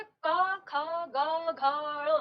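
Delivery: "क, ख, ग, घ, ण" each said crisply from the throat, one by one; reciting consonants. Shown as a demonstration. Teacher to class: throat consonants, each one clearly sounded out. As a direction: spell out "क, ख, ग, घ, ण" slowly from the throat, pausing between each. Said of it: "जब हम इनका उच्चारण करेंगे".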